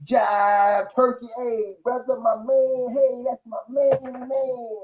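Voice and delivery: high-pitched parody of outdated jive slang; mock someone putting on seventies jive; drawn-out, high mocking jive voice; mock jive talk. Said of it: "That's supposed to be a jive".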